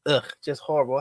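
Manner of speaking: ordinary talk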